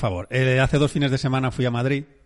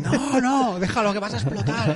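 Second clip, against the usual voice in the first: mock warning to dad; 6 semitones higher than usual. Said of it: "Tú tienes".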